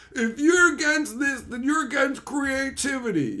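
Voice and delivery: high-pitched mock protest; imitating an offended creator; mock indignant defender. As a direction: whine the argument in a high voice. Mimicking the defenders' argument.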